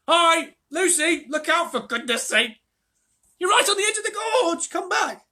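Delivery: high, loud shouts from behind; shouting. A narrator's reading. The others cry out to Lucy at the gorge edge, loud alarmed shouting. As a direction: yell a warning from a distance. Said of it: "voices of the others shouting".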